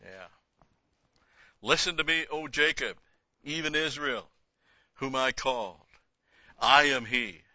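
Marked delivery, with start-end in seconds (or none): 1.6-7.4 loud, raised proclamation, louder; booming scripture reading. Acted. Bible teacher voices God's call, booming. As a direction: call it out as the Lord's own voice. Reads Isaiah forty-eight aloud.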